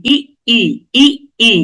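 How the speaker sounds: ই cut short, then ই released afresh; plain glottal catch doubling ই; doubled hamza. Shown as a demonstration. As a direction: stop sharply between each pair of ই. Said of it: "তাসদিদের উচ্চারণ double করতে হবে".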